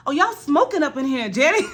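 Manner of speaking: giggling call-out to Janet; laughing mock call-out. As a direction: laugh while calling her roommate out.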